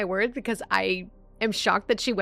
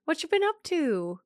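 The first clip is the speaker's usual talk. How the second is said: high, drawn-out cutesy small talk; high-pitched, falling sing-song questions; playful mock greeting; 9 semitones higher, slower than usual. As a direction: greet them in a put-on high voice.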